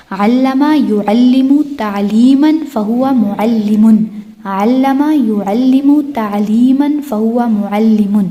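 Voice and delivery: measured, deliberate Arabic recitation; slow drill recitation. Shown as a demonstration. Teacher models a verb family for students, slow recitation. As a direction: recite the word family slowly for memorizing.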